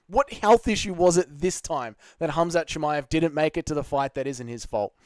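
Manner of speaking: ordinary talk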